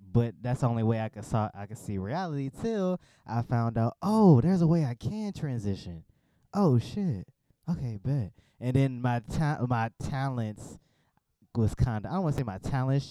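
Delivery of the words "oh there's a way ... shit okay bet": sudden lift of excitement, higher and louder; a burst of discovery; realizing it all at once; excited realization. Retold or imitated. Realizing she can transition.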